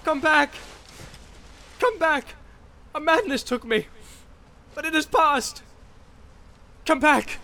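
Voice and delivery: high, pleading calls; frantic high-pitched calling; desperate cries. A narrator's reading. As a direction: beg them to return, calling into the distance. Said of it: "did not even hear his cries".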